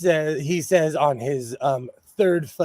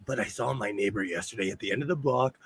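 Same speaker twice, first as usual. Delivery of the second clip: lowered voice for neighborhood gossip; hushed gossip; 6 semitones lower, 7 dB quieter, faster than usual. Real, not acted. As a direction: drop it down, telling a secret. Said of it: "so I'm gonna whisper".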